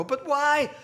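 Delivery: put-on high, loud, drawn-out kid's plea; child's protest. Child to parent told to wait till tomorrow, protesting.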